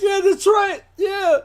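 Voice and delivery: very high, hammed-up baby wail; mock crying. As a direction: imitate sobbing along to tough rap. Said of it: "crying like a baby".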